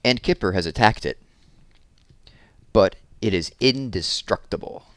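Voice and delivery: lively picture-book read-aloud, big expressive swings; reading to children. A narrator's reading. Teacher reading a balloon story to children, animated storytelling. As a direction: read it like story time for little kids.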